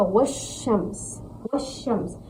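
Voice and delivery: "वशम्स" with wa straight into a doubled sh; silent alif and lam. Shown as a demonstration. Teacher contrasting the two words, no l in a joined "वशम्स". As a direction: skip the l, hold the "sh" in "वशम्स". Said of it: "अलिफ और लाम दोनों नहीं पढ़े".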